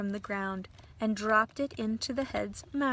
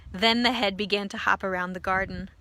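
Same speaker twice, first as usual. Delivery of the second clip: ordinary delivery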